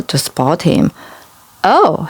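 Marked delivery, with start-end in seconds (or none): none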